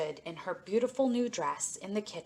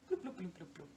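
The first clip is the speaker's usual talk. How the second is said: soft fading bloops; 10 dB quieter, faster than usual. A narrator's reading. Fish swims away, bubbles fade.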